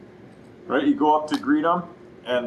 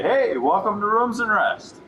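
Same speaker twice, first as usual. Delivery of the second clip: higher, slow, cheerful greeting; friendly welcome; 9 semitones higher than usual. Shown as a demonstration. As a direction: greet the customer like you're glad they came. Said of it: "set the tone that they feel welcome".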